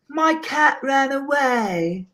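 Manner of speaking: high, slow, drawn-out lady voice; impression of a lady. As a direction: play a lady plaintively voicing her sadness in a high pitch. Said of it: "I love this one lady".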